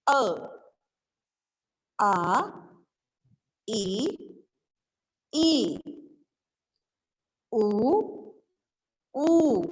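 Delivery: put-on each vowel called out slowly and distinctly for children; teacher chanting the vowel chart one by one; drilling the vowels. Shown as a demonstration. Teacher drills vowels for young pupils, slow clear calls. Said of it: "सही स्वर में पढ़ना है".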